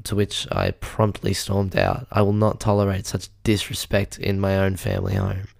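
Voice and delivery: usual speaking voice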